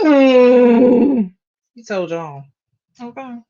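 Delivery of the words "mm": loud sarcastic hum. Real, not acted. Reacting to the clip.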